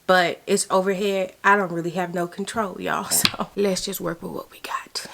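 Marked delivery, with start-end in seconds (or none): 2.8-5.0 whispering. Real, not acted. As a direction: hush it down to a whisper. Whispering in closet.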